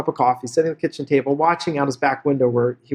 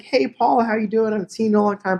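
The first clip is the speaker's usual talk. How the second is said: higher-pitched, lightly voiced friendly greeting; chatty mailman; 5 semitones higher than usual. Mailman greets Paul from high school, lightly voiced chattiness.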